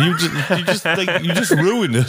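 high, laughing complaint that Dan ruined it; laughing mock scolding. Real, not acted. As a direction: scold him through real laughter. Mock-scolding co-host while laughing.

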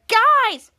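high, loud interruption; calling for attention. Acted. Charlotte interrupts the boys' chatter, calling out.

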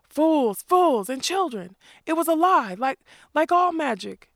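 high, loud, furious scolding; Cully yells at his fleeing men; screaming. A narrator's reading. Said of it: "Captain Cully screamed".

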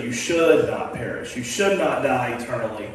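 should hit firmly in both lines; stress on should. Shown as a demonstration. A pastor drives home the word should to his class. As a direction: lean hard on should. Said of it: "So the word is should".

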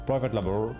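usual speaking voice

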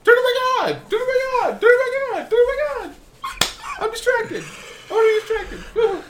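very high, loud squealed plea; shrieky mock-desperate demand; frantic pleading. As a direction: squeal it out like an overexcited child. Begging for lights back on.

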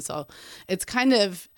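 ordinary talk

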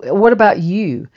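sudden high, loud retort; defensive snap. Retold or imitated. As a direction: throw the accusation right back. Son snapping back at father.

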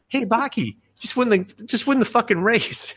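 high-pitched mock pleading with a fighter; exasperated heckling. Viewer urges Baki to win the race, exasperated.

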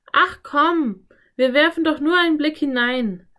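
high, loud, wheedling plea; coaxing. A narrator's reading. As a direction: talk a reluctant friend into it. Anne coaxes reluctant Philipp.

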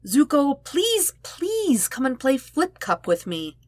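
high, slow begging plea; pleading Sokka. Acted. Sokka begs Zuko to join him at Flip Cup, high and pleading. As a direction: drag out the please like a needy boyfriend.

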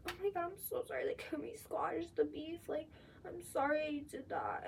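high, mock-sweet apology; hammed-up gushing apology at a party; mocking fake apology. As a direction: imitate a gushing fake-sorry girl. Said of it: "bitches ... they're like".

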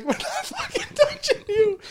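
high, laughing refusal; laughing so hard you can barely refuse. Retold or imitated. Friends refusing to touch Dustin in the bathroom, laughing yell. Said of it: "we're like".

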